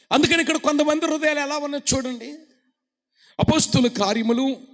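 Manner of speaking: loud, high, fast preacher's call; preaching shout. Acted. Preacher urging the congregation to raise both hands, shouting.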